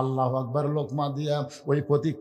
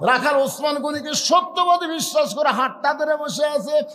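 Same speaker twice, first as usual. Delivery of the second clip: full-force preaching; 11 semitones higher, 9 dB louder than usual. Acted. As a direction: preach it out to a big crowd.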